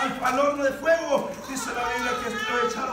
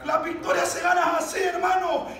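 high, raised, urgent exhortation; full-force preaching; 5 semitones higher, 6 dB louder than usual. Real, not acted. Preacher urges brothers to cry out to the Lord, full-force preaching. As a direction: urge the congregation on with force.

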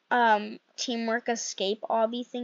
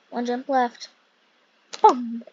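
normal talking voice

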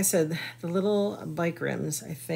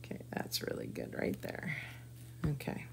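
whispering; 13 dB quieter than usual. Real, not acted. Focused on placing the transfer.

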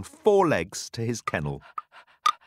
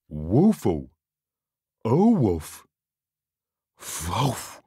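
excited dog barks; 2 semitones lower, slower than usual. Acted. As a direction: bark with delight. Dog happy to be home.

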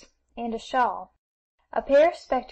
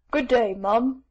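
deep voice; 4 dB louder, slower than usual. Acted. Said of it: "said Demi in a deep voice".

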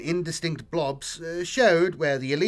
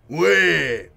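a louder, pressing orc demand; urgent demand; 7 dB louder than usual. Acted. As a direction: demand an answer at once. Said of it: "he demanded urgently".

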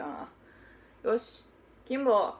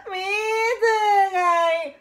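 higher, louder tearful demand; boy insisting on syrup, louder and weepy; whining child; 7 semitones higher, 8 dB louder than usual. Acted. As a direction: blurt it like a spoiled kid about to cry.

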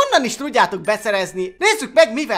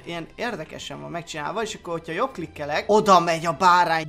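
ordinary delivery

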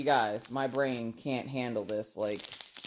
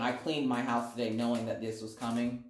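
ordinary talk

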